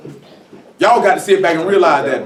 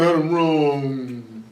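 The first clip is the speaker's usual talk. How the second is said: lower, softer fake sob of "I'm wrong"; mock crying; 5 semitones lower, 4 dB quieter, much slower than usual. Mocking a guilty man crying for sympathy, lower and softer. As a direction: whine it like a guilty man begging for pity.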